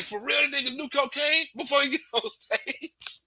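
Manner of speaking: ordinary talk, nothing marked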